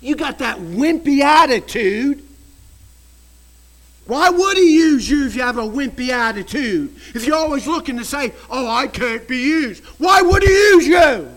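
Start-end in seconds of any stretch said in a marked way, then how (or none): 0.0-7.0 loud scolding challenge; preaching rebuke. Real, not acted. Preacher to congregation, a loud rebuke. 8.5-11.3 preaching shout. Real, not acted. Preaching rebuke.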